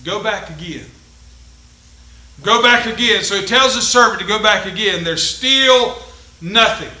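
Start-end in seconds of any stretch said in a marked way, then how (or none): 0.0-0.9 quiet. Shown as a demonstration. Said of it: "get this point now". 2.4-3.2 "Go back again" repeated louder, stress on back. Shown as a demonstration. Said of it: "get this point now".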